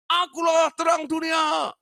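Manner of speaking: very high, slow, loud proclaiming cry; patient's proclamation. Retold or imitated. A mental patient on a table proclaims to his friends, high slow shout. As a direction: call it out like a madman on a table. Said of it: "dia ngomong gitu terus".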